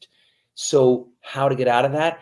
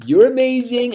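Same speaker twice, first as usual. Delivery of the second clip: put-on high, loud praise to a baby; praising parent; 4 semitones higher, 8 dB louder than usual. Parent cheering a child's first step, high and loud. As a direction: gush like a proud parent. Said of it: "our parents, they said".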